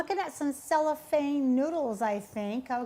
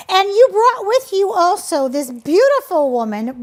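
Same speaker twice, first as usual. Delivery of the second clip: excited; 5 semitones higher, 7 dB louder than usual. Real, not acted. Host introducing guest excitedly.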